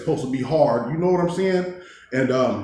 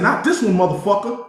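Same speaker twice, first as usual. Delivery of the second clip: retold defiance; 7 semitones higher than usual.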